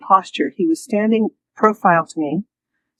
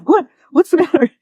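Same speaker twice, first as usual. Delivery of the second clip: alarmed husband; 10 semitones higher, 4 dB louder than usual. Retold or imitated. Husband woken by her screaming.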